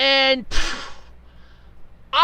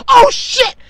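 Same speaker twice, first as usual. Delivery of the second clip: sudden shocked cry, much higher and louder; alarmed outburst; 12 semitones higher, 10 dB louder than usual. Retold or imitated. Spotting the smoking pump in the basement, shocked yell. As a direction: blurt it out in alarm. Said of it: "I'm like".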